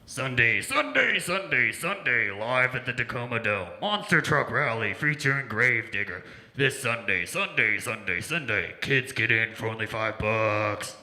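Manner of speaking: booming radio ad announcer, loud and punchy; monster truck ad. Retold or imitated. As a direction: shout it like a monster truck rally spot. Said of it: "those monster truck rallies you hear on the radio".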